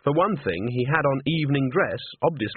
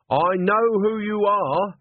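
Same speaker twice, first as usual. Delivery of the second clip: higher, louder, slow blurt; burst out; 9 semitones higher, 4 dB louder, much slower than usual. A narrator's reading. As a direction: blurt it out at the ghost. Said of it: "Agnes burst out".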